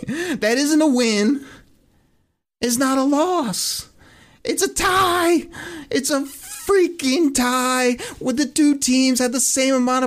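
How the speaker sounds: building disbelief, hammering the word tie; heated rant. Real, not acted. Ranting about the tie result.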